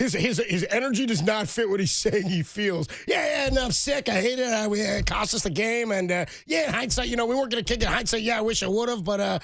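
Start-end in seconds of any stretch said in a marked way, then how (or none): 3.1-9.4 cheery, high mock coach sounding like he won; upbeat mock McVay. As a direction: chirp regrets like a happy winner. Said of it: "Guy sounds like he won the damn game".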